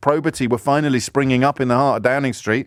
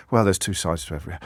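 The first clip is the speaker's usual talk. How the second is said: resigned both-sides; 9 semitones lower, 5 dB quieter, faster than usual. Retold or imitated. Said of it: "we go".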